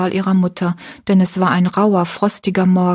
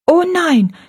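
higher, firm little no; young girl's firm refusal; 10 semitones higher than usual. Acted. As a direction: refuse decisively as a girl. Rosalie refuses firmly.

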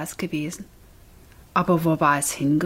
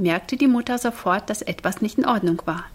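ordinary delivery, nothing marked

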